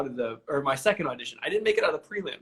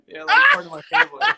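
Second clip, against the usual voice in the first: much higher and louder, laughing through it; 10 dB louder than usual. Real, not acted. Host calls guest family, laughing loud and high. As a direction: burst out laughing while teasing.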